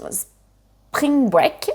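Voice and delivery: Spring Break said slowly in a French-tinged English accent; slow, self-conscious attempt at English pronunciation; clumsy English accent. Real, not acted. French vlogger naming an American holiday, in a clumsy English accent. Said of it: "mon accent anglais est toujours aussi lamentable".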